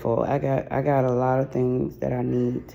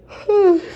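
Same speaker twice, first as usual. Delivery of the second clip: heavy sigh; 9 dB louder than usual. Real, not acted. Sigh alone after sad news.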